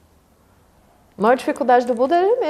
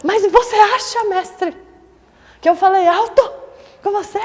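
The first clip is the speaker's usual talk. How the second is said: higher, louder indignant protest; 8 semitones higher, 5 dB louder than usual. Retold or imitated. Student protesting to the master, indignant and raised. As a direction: protest like you were wronged. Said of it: "ele se indignou mais ainda".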